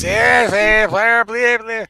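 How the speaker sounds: loud, high mock babble; mocking blah-blah. Mocking what always happens on calls.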